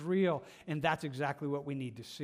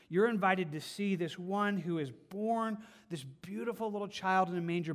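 normal talking voice

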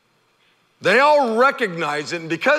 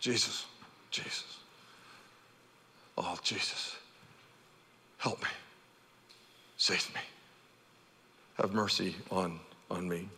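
much softer, lower desperate begging; hushed desperate plea; 12 semitones lower, 17 dB quieter than usual. Retold or imitated. Lepers calling to Jesus, hushed desperate plea. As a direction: breathe the plea like a prayer from the dust. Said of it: "they're yelling out".

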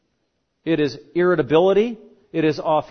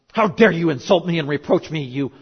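hammed-up telling-off, louder as it goes; indignant outburst; 2 semitones higher, 5 dB louder than usual. Insulted teacher scolds Martha, mock indignant. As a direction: act out an affronted, scolding retort. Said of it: "that would have been me".

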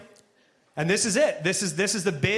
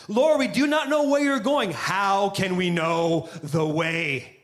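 said slower; slower than usual. Retold or imitated. Thomas repeating the question slowly for Jesus. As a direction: say it slower so he understands. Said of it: "maybe if I say it slower".

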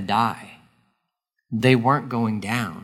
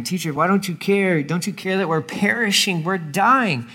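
higher, pleading disciples; panicked plea; 5 semitones higher than usual. Acted. Disciples waking Jesus in the storm, pleading in panic. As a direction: cry out to him as the boat sinks.

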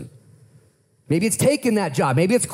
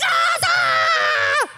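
a short, much higher shriek of alarm; panicked shriek. Acted. Women at the empty tomb, high hammed-up shriek. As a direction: let out a hammed-up scream of panic.

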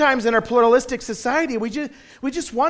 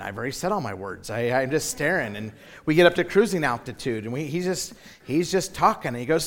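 usual speaking voice

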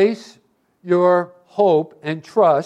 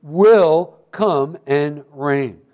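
WILL stressed, then each word slow and weighty; slow right down, landing hard on "will"; emphatic WILL; 3 semitones lower, much slower than usual. Real, not acted. Preacher assuring the church of the coming reign, emphatic WILL. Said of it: "emphasize that word".

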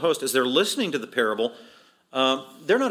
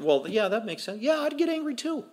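high-pitched, easygoing agreement; imitating a sympathetic onlooker; agreeable listener; 9 semitones higher, 3 dB quieter than usual. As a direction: go along lightly, voice climbing high.

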